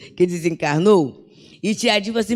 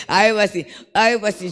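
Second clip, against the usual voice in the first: ordinary talk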